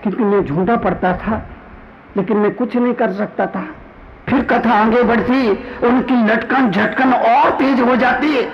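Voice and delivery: ordinary talk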